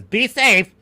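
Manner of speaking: loud, high parody of concern; mocking well-wish. Mimicking people whose farewell he hates, loud mockery. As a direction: parrot it loudly with scorn. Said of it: "I hate it when anyone says".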